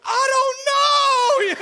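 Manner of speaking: high, stretched-out panicked wail; freaked out. Retold or imitated. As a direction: act out a dad losing it completely. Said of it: "we are just freaked out".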